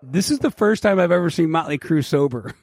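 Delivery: ordinary delivery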